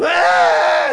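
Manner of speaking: high, loud mock wail; imitated baby cry. Preacher mimicking someone's baby crying, loud high wail. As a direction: bleat out a short baby's wail.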